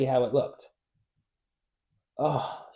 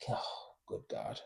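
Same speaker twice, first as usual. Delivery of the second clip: exasperated whisper; 7 semitones lower, 16 dB quieter, slower than usual. Real, not acted. Frustrated after repeated flubs.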